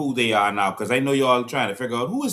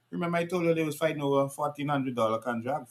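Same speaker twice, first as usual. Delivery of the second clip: ordinary delivery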